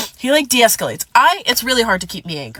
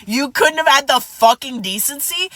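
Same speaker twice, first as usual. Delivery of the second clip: ordinary talk, nothing marked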